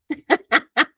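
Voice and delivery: high giggling laugh. Real, not acted. Speaker after asking ladies for questions, high laugh.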